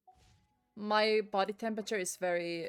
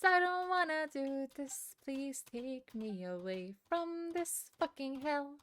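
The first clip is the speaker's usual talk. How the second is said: slow, softer put-on whimper; mock despair; 3 semitones higher, 4 dB quieter, slower than usual. Player begging to escape boring quest, mock whimper. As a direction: plead theatrically to be rescued.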